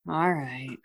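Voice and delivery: soft, resigned all right; resigned sigh. Acted. Woman at the bar grudgingly gets up, quiet all right. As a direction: say it quietly, giving in. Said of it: "does, like, a half sigh".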